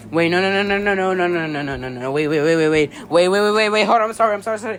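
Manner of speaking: frantic, rising no and wait; announcer losing it in panic; panicked interruption. Acted.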